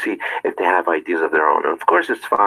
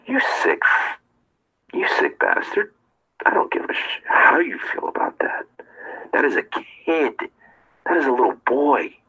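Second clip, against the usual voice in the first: hushed furious whisper, held back; a disgusted inner voice, barely voiced; inner rage; 7 semitones higher than usual. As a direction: seethe it under your breath. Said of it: "in my head".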